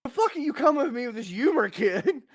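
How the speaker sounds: put-on high, disbelieving yelp at the kid; incredulous laughing outburst. Dad floored by his son's deadpan joke: high incredulous outburst.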